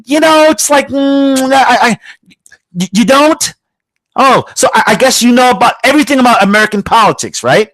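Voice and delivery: half-shouted mock amazement; sarcastic disbelief. Real, not acted. Incredulous sarcastic retort to foreigners.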